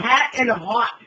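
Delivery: slow, loud Chicago vowels; exaggerated Chicago accent on "Hat and hot". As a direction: lay on the Chicago accent, stretching both words. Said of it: "those goddamn Chicago accents".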